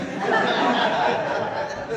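breathy high laugh; high wheezy laugh. Real, not acted. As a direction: burst out laughing at the memory. Laughing at own story.

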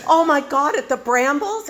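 aghast that it happened there; shocked. Retold or imitated. Daughter horrified about grandma, shocked squeal. Shocked teen at restaurant news.

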